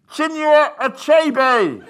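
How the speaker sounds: slow, high muffled shout through a helmet. Retold or imitated. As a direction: call it out through a full-face helmet. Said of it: "his voice muffled by the full-face helmet".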